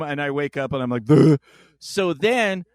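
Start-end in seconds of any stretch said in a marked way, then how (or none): 1.0-1.4 groggy blurt. Retold or imitated. Waking from being choked out.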